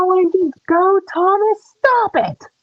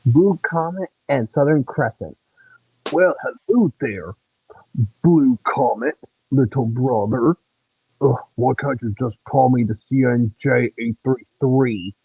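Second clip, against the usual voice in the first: much lower put-on engine voice; deep engine voice. Child voicing big brother engine teasing little brother, deep and low. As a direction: rumble it out like a big old steam engine.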